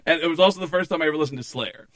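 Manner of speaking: ordinary talk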